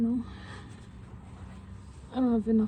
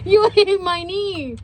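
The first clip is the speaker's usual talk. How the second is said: high, loud and slow protest; startled complaint; 7 semitones higher, 6 dB louder, slower than usual. Real, not acted. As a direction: yelp it in pained surprise. Knee just hit.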